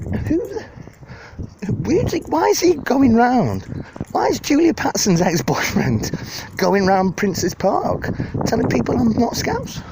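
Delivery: high, slow, outraged mock rant; hammed-up celebrity offended by gossip. Imagined [name] outraged at the internet video, high mock rant. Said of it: "She's like".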